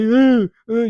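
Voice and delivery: loud, high mock wailing; imitated crying. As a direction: wail as if crying while walking. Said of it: "llorando y andando". (llanto imitado, fuerte y agudo)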